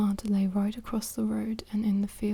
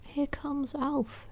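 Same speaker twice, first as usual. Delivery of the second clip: higher, slow whispered woman's voice; whispered Mrs Thompson; 5 semitones higher, 5 dB quieter, slower than usual. Acted. Mrs Thompson tells Pat that Alf is coming, higher slow whisper. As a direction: lift it up and draw it out for Mrs Thompson.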